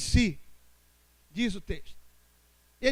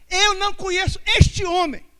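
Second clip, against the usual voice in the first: louder, higher emphatic denial; forceful denial; 4 semitones higher, 7 dB louder than usual. Acted. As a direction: deny it hard and loud. Peter's third denial.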